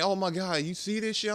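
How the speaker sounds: high, hurried gushing; imitated excited friends. Friends telling him about the tweet, mock excited. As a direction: mimic friends buzzing over news. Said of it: "my people are saying".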